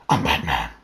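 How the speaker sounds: deep gravelly growl; low whispered hero growl; Batman voice. Pretend. Batman impression.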